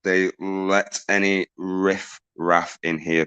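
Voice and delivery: normal talking voice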